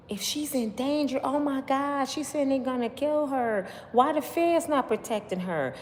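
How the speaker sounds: high-pitched worried onlooker imitation; put-on high voice of concern; mock alarm. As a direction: imitate people fretting for her safety. Voicing worried people's comments.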